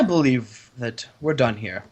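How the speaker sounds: cold low dismissal. Acted.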